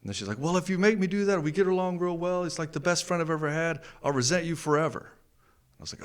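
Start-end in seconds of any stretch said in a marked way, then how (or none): 0.4-5.1 higher mock wife voice; lightly voiced wife, pitched up; mimicking wife. Husband mimicking his wife's threat, higher voice.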